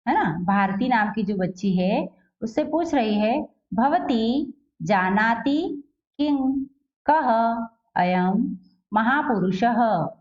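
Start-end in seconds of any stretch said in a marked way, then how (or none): 3.7-10.1 भवती जानाति किं कः अयं महापुरुषः read slowly, each ः as "ha"; word by word, with किं as "king" and कः as "kaha"; slow Sanskrit model reading. Shown as a demonstration. Teacher reading the textbook question to pupils, slow and syllabic. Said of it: "इसलिए हमने अह लगाया".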